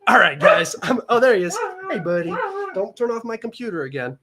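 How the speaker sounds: pet talk. Real, not acted. Talking to the cat.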